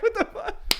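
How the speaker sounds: very high-pitched incredulous reaction; mock bafflement. Fan reacting to the accent, mock disbelief.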